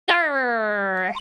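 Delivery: low rumbling tiger growl. Acted. Acting out a tiger growl in the circus tent, low. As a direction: growl low like a big cat. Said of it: "[name] making tiger noises".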